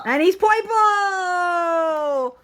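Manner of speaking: slow, high, delighted exclaim; excited drawn-out echo. Real, not acted. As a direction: stretch it out with delight.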